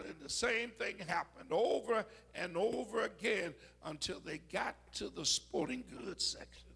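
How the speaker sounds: usual speaking voice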